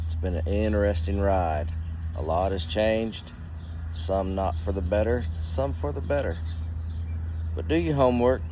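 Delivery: normal talking voice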